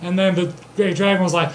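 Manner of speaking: normal talking voice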